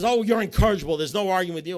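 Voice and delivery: loud, high-pitched scolding; exasperated wife. Retold or imitated. Wife giving up on arguing with him, loudly exasperated. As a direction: throw up a loud, fed-up complaint as the wife. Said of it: "She says".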